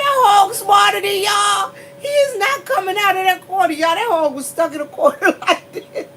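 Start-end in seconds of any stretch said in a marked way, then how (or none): 0.0-3.7 high-pitched retold outcry; animated, high mock cry of disbelief; worked-up exclamation. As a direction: exclaim it high, nearly wailing. Retold exclamation about stubborn hog.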